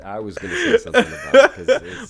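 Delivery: high, loud laughing protest. Real, not acted. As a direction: talk through real cracking-up laughter. Cracking up at anime topic.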